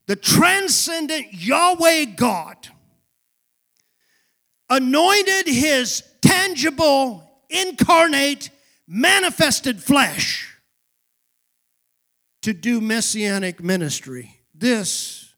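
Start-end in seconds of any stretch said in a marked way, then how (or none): 0.1-10.5 very slow, higher-pitched ringing proclamation; declamatory preaching. Real, not acted. Preacher unveiling the meaning of the baptism, slow declamatory preaching. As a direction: proclaim each weighty word separately.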